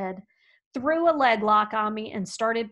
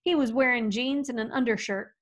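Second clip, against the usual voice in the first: ordinary talk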